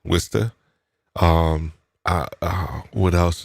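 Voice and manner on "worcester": Worcester said as wuss-tuh; Worcester the Boston way, wuss-tuh; local way. Shown as a demonstration. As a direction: clip Worcester to two syllables, wuss-tuh. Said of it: "not Worcester".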